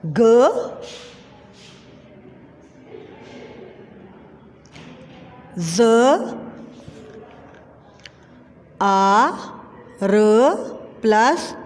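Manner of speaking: slow, drawn letter-by-letter spelling. Shown as a demonstration. Teacher spelling a word for pupils, slow and drawn out. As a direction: sound out each letter slowly with long gaps. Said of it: "तो क्या लफ्ज़ बनेगा".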